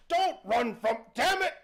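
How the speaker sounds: loud holler jumping high; exasperated genie. Acted. Genie calls after the fleeing boy, exasperated shout. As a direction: shout after someone running away, exasperated. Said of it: "The genie is like".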